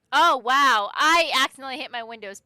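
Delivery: loud startled outburst, easing off. Real, not acted. Streamer reacting to an accidental button press, loud and startled.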